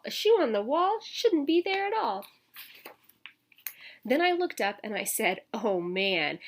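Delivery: lively storybook read-aloud; reading to children. A narrator's reading. Teacher reading a picture book to children, lively. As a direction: read it out to the kids with bounce.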